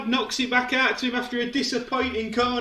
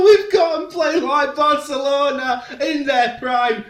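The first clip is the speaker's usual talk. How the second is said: louder, higher and slow, savouring every word; gleeful boasting; 4 semitones higher, 4 dB louder, slower than usual. Real, not acted. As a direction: crow over the team's play, drawing it out. Gloating over team's passing play.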